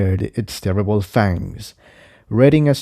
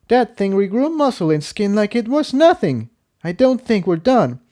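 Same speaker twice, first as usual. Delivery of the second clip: much higher voiced mare, grim; Incendia doubtful; 11 semitones higher than usual. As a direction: warn that the fight goes on. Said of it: "Incendia said".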